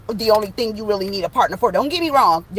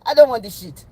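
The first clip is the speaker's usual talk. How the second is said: louder mock African accent; 2 semitones higher, 4 dB louder than usual. Rejecting the partner thrown back, African accent. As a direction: push the refusal out in a put-on African accent. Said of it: "In my African voice".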